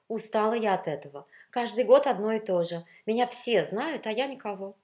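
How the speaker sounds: high, loud, plaintive grumble; worked-up, self-pitying lament; fretful complaint. Acted. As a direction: pour out exasperation to a husband. Wife complains wearily to husband.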